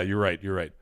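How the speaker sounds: usual speaking voice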